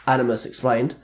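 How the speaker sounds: ordinary delivery, nothing marked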